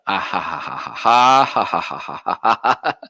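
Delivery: forced run of ha-has; staged laugh. Shown as a demonstration. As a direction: fake a hearty laugh to test the mic. Test laughs into microphone.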